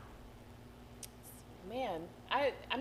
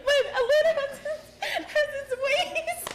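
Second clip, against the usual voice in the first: higher, louder, through laughter; laughing; 7 dB louder than usual. Real, not acted. Host laughing while defending Atlanta. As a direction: say it while still cracking up.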